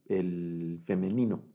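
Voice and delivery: normal talking voice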